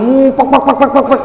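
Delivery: light "pek pek" sputter like an exhaust; imitated flapping noise. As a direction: mimic skin flapping in the wind. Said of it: "Awak kira suara knalpot". (tiruan bunyi "pek pek" seperti knalpot)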